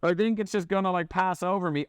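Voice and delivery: lightly voiced head-in-sand type; mock oblivious ostrich. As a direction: parody someone who ignores trouble. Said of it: "It's like".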